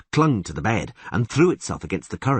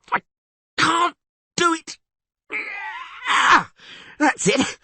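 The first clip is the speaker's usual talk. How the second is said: put-on high, eager, excitable young bard; excited bard voice; 12 semitones higher than usual. Dandelion marvels at the huge catfish, high and excited.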